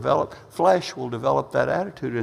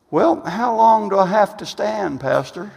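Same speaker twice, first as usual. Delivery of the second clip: higher-pitched mock complaint; questioning church member; 7 semitones higher than usual. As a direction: lightly play a congregant pleading with the pastor.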